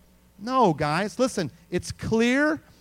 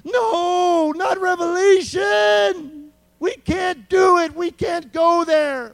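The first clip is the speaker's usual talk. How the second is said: high, loud mock panic; inner scream; 10 semitones higher, 6 dB louder, much slower than usual. Preacher voices people's inner panic, high mock scream. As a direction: shout it like a terrified believer. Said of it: "they're screaming".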